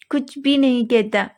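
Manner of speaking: usual speaking voice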